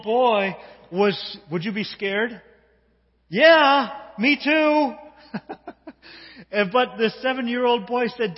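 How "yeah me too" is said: laughing. Real, not acted. Admits he'd be scared too.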